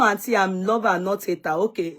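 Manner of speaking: ordinary delivery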